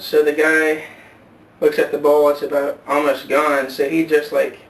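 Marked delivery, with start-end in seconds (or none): none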